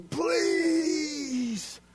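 high, loud pleading cry; desperate scream. Retold or imitated. A deceived soul screaming for mercy. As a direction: scream it like someone lost and begging. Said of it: "deceived screaming".

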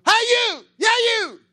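high, loud taunting call; mock taunting shout. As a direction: yell it up the cliff at the enemy. Calling out to enemy soldiers.

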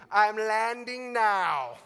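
loud, high, very drawn-out words; acted insistent shout. Pilot cutting short radio protocol to land, insistent shout. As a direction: override them, loud and stretched.

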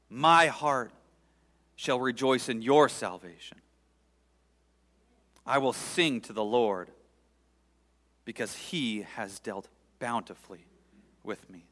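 loud, rising lament read to God; fervent psalm prayer. Acted. Preacher praying the psalm aloud before his congregation, pleading.

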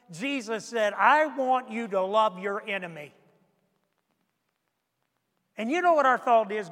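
loud, driving pulpit delivery; fiery preaching. Acted. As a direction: hammer the questions home to the congregation. Preaching at full force.

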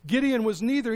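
ordinary talk, nothing marked

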